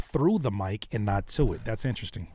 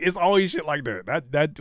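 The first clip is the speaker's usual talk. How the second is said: ordinary delivery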